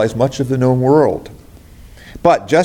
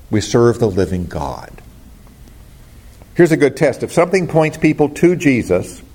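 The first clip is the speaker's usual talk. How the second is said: ordinary delivery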